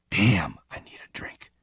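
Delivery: breathless whisper to himself; whispered aside. Acted. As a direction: whisper it under the breath, craving a drink. Stalker's inner craving, unvoiced.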